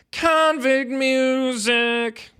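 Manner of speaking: high, slow froggy sung voice. Retold or imitated. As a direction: draw out the first word like a croaking singer. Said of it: "a bit of a frog voice going on".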